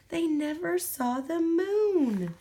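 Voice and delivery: plain reading voice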